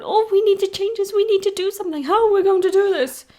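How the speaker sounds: high, frantic mock superhero whine; high panicky mock voice. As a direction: squeak it out like a flustered hero. Mocking panicked Avengers.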